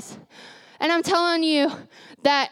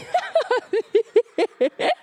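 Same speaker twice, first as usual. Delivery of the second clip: high, breaking laugh; nervous laugh; 8 semitones higher than usual. Real, not acted. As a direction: laugh through emotion.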